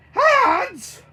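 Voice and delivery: high, loud shriek of alarm; yelled. Acted. Bert sees claws coming from the hands, high yell. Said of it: "Bert yelled".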